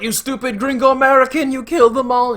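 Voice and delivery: high, loud imitation of a furious accuser; mock angry yelling. Mexican man at gunpoint accusing the killer, mock yelling. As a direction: yell the accusation, hammed up. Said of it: "he's yelling at him".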